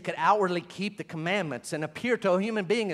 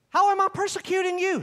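higher, indignant protest; mock question; 13 semitones higher, 5 dB louder than usual. Preacher imagining Saul protesting, a hint of indignation. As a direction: ask it as an outraged skeptic. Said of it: "what he didn't do?".

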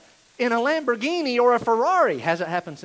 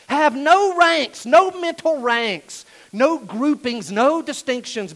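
slow, louder, higher hammered words; shouting; 5 semitones higher, 5 dB louder, slower than usual. Real, not acted. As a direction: pound out each word. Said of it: "I'm shouting because".

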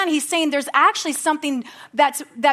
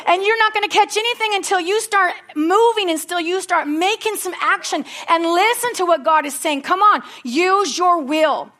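raised, ringing preacher's call to act; full-force preaching; 4 semitones higher than usual. Acted. Pastor urging the congregation to act, full-force preaching. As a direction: preach it up to the whole church.

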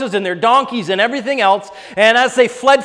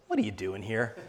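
still small voice; 11 semitones lower, 15 dB quieter than usual. Retold or imitated. God's voice quoted again.

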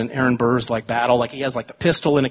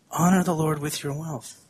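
soft solemn repeat; 6 dB quieter than usual. Real, not acted. Pastor repeats verse for emphasis.

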